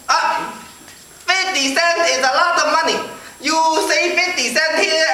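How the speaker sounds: put-on high, insistent Chinese-accented lecture. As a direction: persuade the customer in a mock Chinese accent. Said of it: "this Chinese guy came out".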